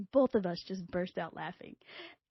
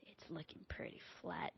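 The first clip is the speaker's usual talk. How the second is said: breathy unvoiced whisper of doubt; whispered aside; 3 semitones lower, 15 dB quieter than usual. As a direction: mutter it under your breath, deflated.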